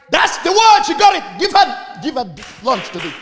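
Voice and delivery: shouted high and loud in delight; excited shout. Real, not acted. Preacher shouting praise to a woman who answered right. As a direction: cry it out, thrilled with her answer.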